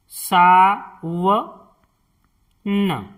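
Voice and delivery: Sawan drawn out slowly as सा-व-न; slow syllable-by-syllable. Shown as a demonstration. Teacher sounding out Sawan to show how to write it, slowly. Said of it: "जैसे आपको लिखना है सावन".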